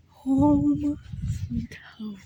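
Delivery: breathy whisper on sweet home. Shown as a demonstration. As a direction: drop to a whisper at the end.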